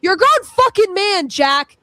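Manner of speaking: real furious jab at a grown man; angry outburst. Real, not acted. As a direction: let him have it, voice rising. Heated argument, insulting Jack.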